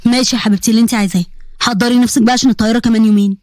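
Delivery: low voice, gently pleading. Acted. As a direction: ask a favour like a loving uncle.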